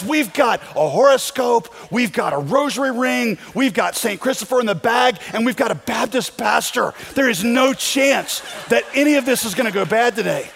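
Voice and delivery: high, loud triumphant tally, hammed up; over the moon. Woman tallying lucky charms before a flight, over the moon. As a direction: count off each item with mounting thrill, imitated. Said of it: "is now over the moon".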